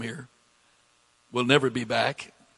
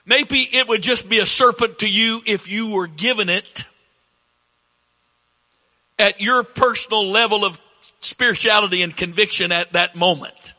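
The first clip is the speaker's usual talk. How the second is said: higher, louder preacher's voice; forceful preaching; 8 semitones higher, 6 dB louder than usual. Real, not acted. Preacher paraphrasing scripture for the congregation, forceful preaching. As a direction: press the point to the congregation, loud and high.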